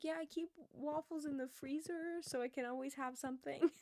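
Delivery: put-on high, loud sweet little old lady; old lady impression. Old coworker explains her sandwiches, high old-lady voice. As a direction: imitate an adorable elderly coworker. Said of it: "this is how she talks".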